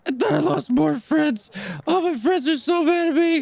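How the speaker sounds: high, wailing creature voice, nearly sobbing; crying and wailing. Acted. Little creature wailing to the horse, high and tearful. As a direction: wail it out like a heartbroken little creature. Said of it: "still crying and wailing".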